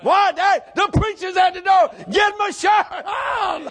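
high, loud revival shout; impassioned preacher at a shouting peak; full-force preaching. Acted. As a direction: holler it to the whole congregation.

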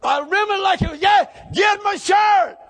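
high, loud revival shout; impassioned preacher at a shouting peak; full-force preaching. Acted. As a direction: holler it to the whole congregation.

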